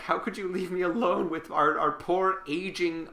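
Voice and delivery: hammed-up aggrieved relative; whiny complaint. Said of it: "is like".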